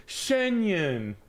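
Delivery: high, sing-song mock announcer; mock hyped high voice; mock excitement. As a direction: hype it like an ad. Mock excited Shen Yun.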